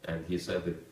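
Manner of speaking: ordinary talk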